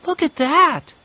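high, bright-eyed exclamation of surprise; exclaiming. A narrator's reading. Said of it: "Violet exclaimed".

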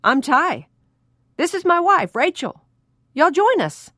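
high, booming Southern greeting; loud. Acted. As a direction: call it out at full volume. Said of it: "replied at a matching decibel level".

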